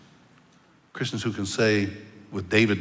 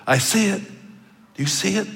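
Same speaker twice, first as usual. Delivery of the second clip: awed excitement; 10 semitones higher, 4 dB louder than usual. Retold or imitated. Dying preacher sees heaven.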